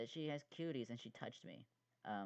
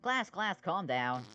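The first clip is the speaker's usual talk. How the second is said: loud stern teacher calling the class to order; stern shout; 10 dB louder, slower than usual. Acted. Teacher shouting over a laughing class, loud and stern. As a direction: bark at the rowdy class to settle down.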